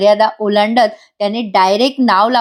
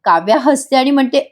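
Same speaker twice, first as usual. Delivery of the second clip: ordinary delivery, nothing marked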